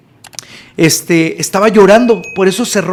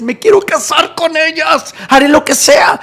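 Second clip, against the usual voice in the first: desperate plea; 8 semitones higher than usual. Retold or imitated. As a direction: wail it like a lovesick man. Second take, escalated desperate plea.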